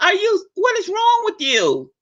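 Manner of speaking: high incredulous question; hammed-up disbelief at a friend; high, exasperated disbelief. Mocking her friend.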